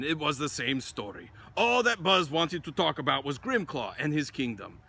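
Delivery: ordinary delivery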